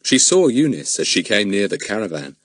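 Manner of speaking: plain reading voice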